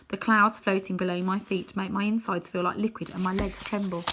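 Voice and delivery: plain reading voice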